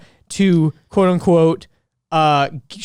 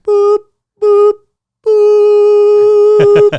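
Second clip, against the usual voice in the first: squeaky high beeps, much louder; high loud boop; 9 dB louder, much slower than usual. Retold or imitated. Someone in the background beeping, high loud boops. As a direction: chirp boop like a cartoon beeper. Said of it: "someone in the background is like".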